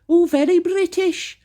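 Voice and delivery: high, put-on "very British" voice; mock advert woman. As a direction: mimic her in a high, affected voice. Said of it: "offering somebody a cup of tea and she goes".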